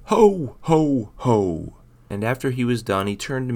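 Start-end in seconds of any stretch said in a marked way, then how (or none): 0.0-1.7 Santa yelling. A narrator's reading. Santa calls out at the end of the night, booming. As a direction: belt it out across the night sky. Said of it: "yell out".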